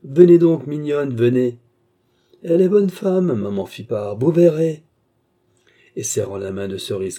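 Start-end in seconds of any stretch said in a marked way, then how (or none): 0.0-4.8 loud, high-pitched coaxing in bursts; insistent coaxing. A narrator's reading. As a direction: coax her along insistently. Vieille presse la fille de venir. (insistance cajoleuse, voix haute et forte)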